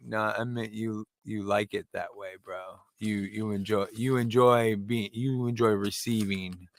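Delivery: low, slow, awkward explaining; confiding explanation. Retold or imitated. Dad explains the dirty joke to his teenage son, low awkward explanation.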